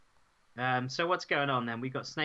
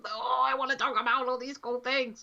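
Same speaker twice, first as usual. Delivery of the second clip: gushing excitement; 11 semitones higher than usual. Retold or imitated. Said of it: "I'm like".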